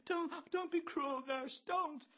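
very high, slow squeak. A narrator's reading. Bloom begs as Bello twists the arm, squeaking. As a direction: squeak a falsetto plea. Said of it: "Bloom squeaks".

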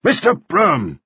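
sharp judge's rebuke; snapped. Acted. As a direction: cut him off curtly. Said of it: "snapped the justice".

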